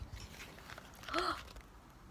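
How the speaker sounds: high breathy gasp; storyteller gasping for the children; whispered gasp. Acted. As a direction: gasp before turning the page. Reader builds suspense.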